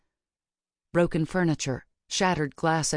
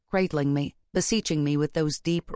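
plain reading voice

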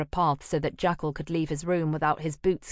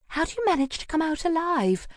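put-on coy young woman; 12 semitones higher than usual. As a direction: play her wide-eyed and coy. Llewellyn character voice.

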